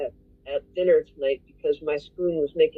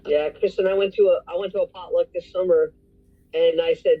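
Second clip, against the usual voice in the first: ordinary talk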